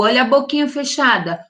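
ordinary talk, nothing marked

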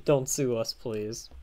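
ordinary talk

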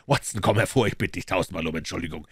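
ordinary delivery

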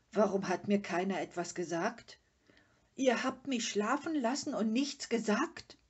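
loud, offended questioning; indignant reproach. A narrator's reading. Father offended at being left out. (empört und vorwurfsvoll)

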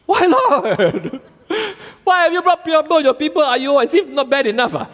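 high, loud half-laughing lament; hammed-up frustrated plea to God; Moses complaining. Preacher as Moses protesting to the Lord, high and loud. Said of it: "Moses returned to the Lord and said".

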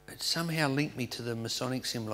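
ordinary talk

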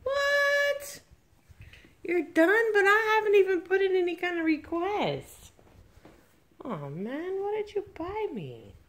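high, loud mock shock; mock surprise. Real, not acted. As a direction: gasp in playful disbelief. Surprise at Christmas shopping news.

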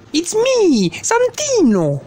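voz de personaje muy aguda, con acento marcado; preséntate en agudo, alargando mucho el nombre; Santino. Acted. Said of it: "acento tan marcado". (presentación chillona con acento exagerado)

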